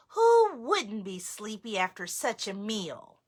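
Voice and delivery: ordinary delivery, nothing marked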